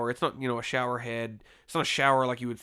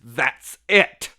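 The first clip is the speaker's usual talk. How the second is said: loud, abrupt imitation of the line; imitating T J; 11 dB louder than usual. T J stops the fight, loud blunt mock declaration. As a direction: blurt it out suddenly like a mock movie line. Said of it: "just the way he says it".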